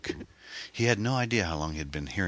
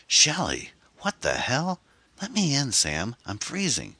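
high, whiny and impatient; impatient whine; 11 semitones higher than usual. A narrator's reading. Freezing Shelley begging Sam to let her in, whining. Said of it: "she whined impatiently".

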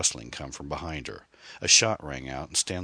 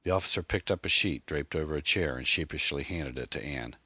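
usual speaking voice

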